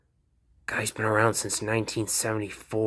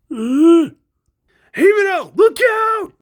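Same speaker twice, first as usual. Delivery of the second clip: much higher, loud cry; warning shout; 12 dB louder than usual. Acted.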